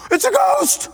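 very high mock-frightened shriek; terrified cry. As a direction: yelp it in acted terror. Voices the terrified disciples.

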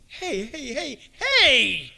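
a hint of God's gentle rebuke; voice of the Lord scolding. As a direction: stop them short, then plead for love. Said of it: "the Lord says to you".

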